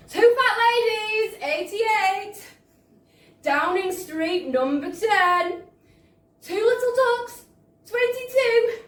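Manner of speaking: high, loud sing-song calling of numbers; bingo caller. Acted. Bingo caller calls numbers to the hall, high and loud.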